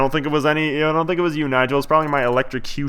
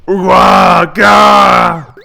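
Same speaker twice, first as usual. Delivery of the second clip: loud guttural mock roar; big cartoon attack yell; battle cry; 5 semitones higher, 10 dB louder than usual. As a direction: roar out a nonsense monster cry. Same battle cry repeated.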